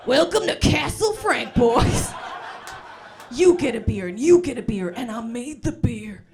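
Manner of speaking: loud, hearty dad hosting his buddies; booming basement dad. Acted. Dad welcoming the boys to his basement suite, booming. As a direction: shout a big mock dad welcome. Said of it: "sounds like your dad's renovated basement suite".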